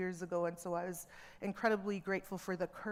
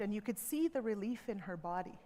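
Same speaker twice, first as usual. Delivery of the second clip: ordinary delivery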